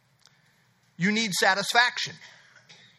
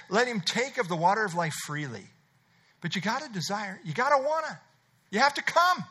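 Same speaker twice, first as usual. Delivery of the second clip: ordinary delivery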